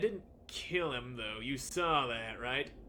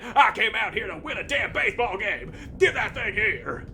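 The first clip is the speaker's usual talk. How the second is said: angry coach shout; 7 semitones higher, 9 dB louder than usual. Acted.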